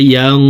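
"ইয়াং" with a long, hidden nasal n; ikhfa ghunnah. Shown as a demonstration. Teacher naming the ghunnah rule, "ইয়াং" held in a nasal hum. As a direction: hum the end of "ইয়াং" through the nose. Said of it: "ইকফাহ গুন্না".

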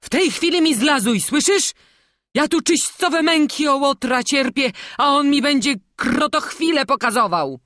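high, loud angry scolding; angry old master. A narrator's reading. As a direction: scold the boy sharply.

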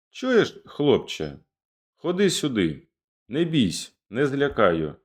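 high call on the first word, then easing; calling to the lad. Acted. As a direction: beckon the boy over, lifted but friendly. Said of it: "Залізняк гукає".

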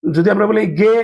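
ordinary talk

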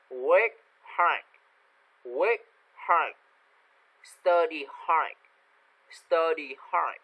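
isolated words, carefully articulated with long gaps; pronunciation model reading. Shown as a demonstration. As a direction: read each word out clearly for learners to copy. Models vocabulary for repetition. (đọc mẫu từng từ rõ ràng)

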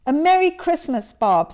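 mean every word of it; warm, earnest Merry Christmas to Bob; earnest greeting. A narrator's reading. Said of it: "with an earnestness that could not be mistaken".

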